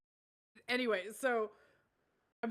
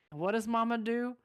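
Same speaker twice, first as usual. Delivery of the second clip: ordinary talk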